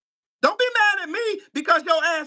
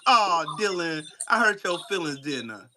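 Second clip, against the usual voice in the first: mock pity; 7 semitones lower than usual. Real, not acted.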